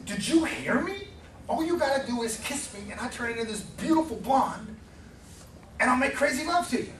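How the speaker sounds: a frog losing patience, pushing the offer; the frog repeats his offer, high and insistent; impatient frog. Acted.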